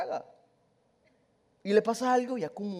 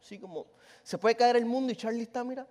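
normal talking voice

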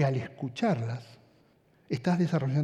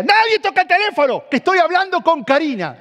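put-on much higher and louder, a thrilled teen shouting; excited yell; 13 semitones higher, 12 dB louder than usual. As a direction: shout to the whole house that she's on the line. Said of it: "Y yo decía".

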